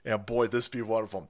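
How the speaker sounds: ordinary talk, nothing marked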